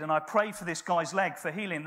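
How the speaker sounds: usual speaking voice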